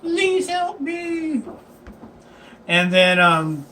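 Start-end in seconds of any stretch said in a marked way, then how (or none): 0.0-1.4 high, drawn-out mock little-kid plea; little kid pleading. Little kid begs adventurers for help, mock pleading. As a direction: put on a slow, wailing child's voice. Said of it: "A little kid comes cu-, running like".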